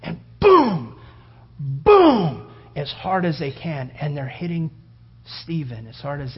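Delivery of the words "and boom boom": loud shouted booms; stone impacts. Retold or imitated. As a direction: hammer out each impact. Acting out stones hitting.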